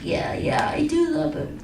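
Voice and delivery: whispered through tears; imitating a crying woman's whispered yes; tearful whisper. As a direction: breathe out a teary yes. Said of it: "In her tears".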